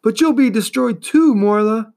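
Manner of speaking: put-on boy angrily crying out to the turtle; angry cry. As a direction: shout it at her angrily. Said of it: "cried Atreyu angrily".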